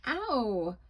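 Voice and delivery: sharp high yelp of pain; pained cry. Acted. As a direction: yelp as the face burns. Burning face pain.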